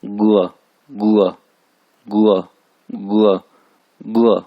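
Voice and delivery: गुआ with hard g, tone falling; emphatic falling गुआ. Shown as a demonstration. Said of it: "जी को पढ़ रहा गाफ से".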